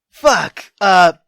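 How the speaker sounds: ordinary talk, nothing marked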